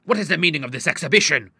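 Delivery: furious father questioning; angry. A narrator's reading. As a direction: challenge them with outrage. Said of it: "he cried angrily".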